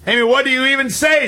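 high-pitched quote of an exasperated parent; flustered parent. Retold or imitated. Parent at a loss over child's question, exasperated.

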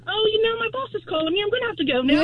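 high, bright mock British excuse; hurried English-accent getaway; faking a British accent to escape a real Englishman; fake English accent. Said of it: "I was using my English accent".

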